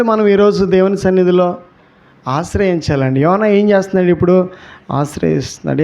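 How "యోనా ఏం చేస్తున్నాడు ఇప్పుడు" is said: ordinary talk, nothing marked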